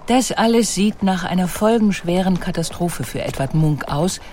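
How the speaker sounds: ordinary delivery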